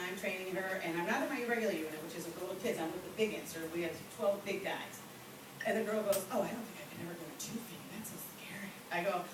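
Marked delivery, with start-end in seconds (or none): 6.3-8.7 quick, hushed worry; nervous trainee. Retold or imitated. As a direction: imitate a timid trainee, fast and soft. Nervous trainee voiced in story.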